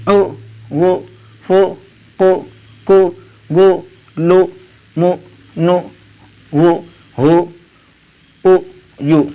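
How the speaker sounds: each letter with an "oo" sound, as in "بو، تو"; pesh vowel drill. Shown as a demonstration. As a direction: recite "او، بو، تو" one by one, rounded "oo", pause after each. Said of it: "پیش کی حرکت لگاتے ہیں تو اس کی آواز او". (حروف "او، بو، تو" پیش کے ساتھ، او کی آواز میں الگ الگ)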